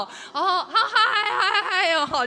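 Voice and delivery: high, loud, laughing carefree greeting; mock breezy latecomer. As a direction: ham up a guy who acts like nothing happened. Late man strolls in whistling. (高亢带笑、满不在乎的迟到者)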